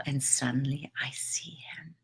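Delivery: breathy whisper, barely voiced; hushed whisper. Real, not acted. Telling of seeing the cat again, whispered. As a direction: whisper so you don't scare the cat.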